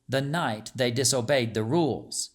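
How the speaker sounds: usual speaking voice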